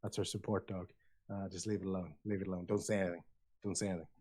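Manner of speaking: hushed, low aside; acted whisper. Staff explaining a support dog, hushed warning. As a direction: drop to a low, discreet murmur. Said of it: "you can see him kind of whisper".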